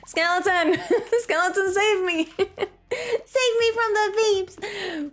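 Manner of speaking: high, drawn-out cry for help through laughter; giggly, hammed-up damsel in distress; mock plea for rescue. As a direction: plead playfully for saving, pitched high.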